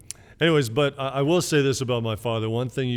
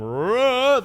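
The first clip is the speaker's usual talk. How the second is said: a loud, high hollered name; bellow homage; 14 semitones higher, 8 dB louder than usual. Retold or imitated. Family repeats dad's bellow at home as homage, loud call. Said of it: "we actually do that at our house".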